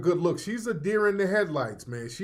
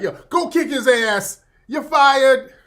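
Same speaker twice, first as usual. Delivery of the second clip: raised voice, high and loud; mock Trump shout; 8 semitones higher, 10 dB louder than usual. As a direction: shout orders like Trump at a rally. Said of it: "at least Trump can control the crowd".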